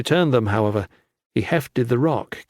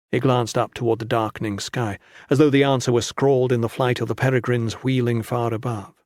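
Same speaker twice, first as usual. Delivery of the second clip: usual speaking voice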